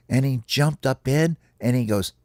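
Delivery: ordinary delivery, nothing marked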